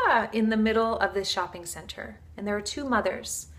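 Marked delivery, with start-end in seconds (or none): 0.1-0.3 mock baby scream. Imitating a baby screaming in a shopping center, mock scream.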